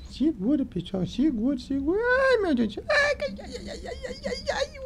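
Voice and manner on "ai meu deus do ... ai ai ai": panicked high cries. Acted. Ant falling, panicked cries.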